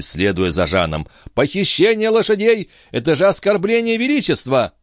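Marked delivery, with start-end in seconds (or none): 1.3-4.7 high, protesting outcry; outraged wail. A narrator's reading. Stationmaster accuses Jean of theft, outraged wail. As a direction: protest it in a high-pitched cry. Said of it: "вопил смотритель".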